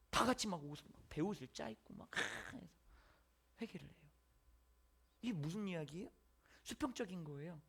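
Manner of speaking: usual speaking voice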